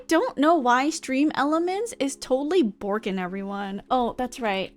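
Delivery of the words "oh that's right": ordinary talk, nothing marked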